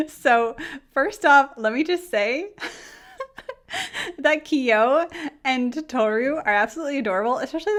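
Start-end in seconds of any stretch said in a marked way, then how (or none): 3.2-4.1 squeaky laugh. Real, not acted. Laughing before gushing.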